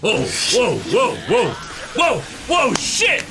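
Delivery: ordinary talk, nothing marked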